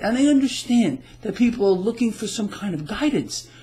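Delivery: ordinary talk, nothing marked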